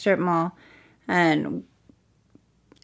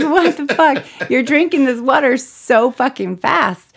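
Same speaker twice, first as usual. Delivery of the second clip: cracking up while asking the tree; laughing at the thirsty plant; laughing disbelief; 6 semitones higher, 6 dB louder than usual. Retold or imitated. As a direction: laugh through it, higher and louder.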